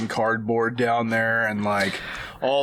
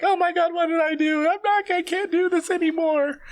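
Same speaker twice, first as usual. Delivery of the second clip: crying mom. Acted. Mother breaking down over her son, high crying. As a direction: sob it out at the top of the voice. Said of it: "She's crying".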